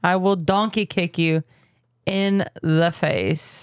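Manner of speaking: drawn out slowly at the end; slow down on "in the face" for emphasis; deliberate mock threat. Repeats threat after "Excuse me?.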